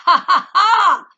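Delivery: loud, high burst of triumphant laughter. Real, not acted. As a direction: laugh out loud at them, gloating. Mocking enemies, triumphant laugh.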